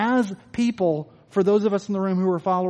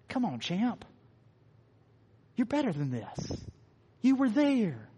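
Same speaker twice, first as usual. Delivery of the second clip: much softer, tender encouragement; loving dad; 3 semitones higher, 7 dB quieter than usual. Retold or imitated. Loving dad encouraging his struggling son, tender and soft. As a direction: reassure him like a dad to his kid. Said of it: "a loving dad responding with".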